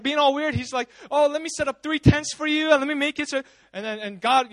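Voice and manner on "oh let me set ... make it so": higher-pitched eager offer; mock over-eager helper; eager babbling. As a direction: gush the offer out, raised in pitch. Retelling a flustered disciple's eager offer.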